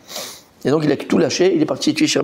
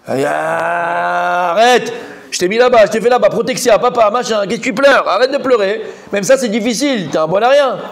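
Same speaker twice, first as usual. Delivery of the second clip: scolding father; 4 semitones higher than usual. Retold or imitated. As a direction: scold him like a fed-up dad. Father scolding crying son, acted.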